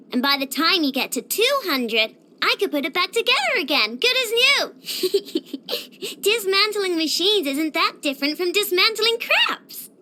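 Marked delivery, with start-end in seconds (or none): 4.8-6.1 short, light, airy giggle; quick self-satisfied titter. Acted. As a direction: giggle to yourself, pleased. Pleased with own crab joke.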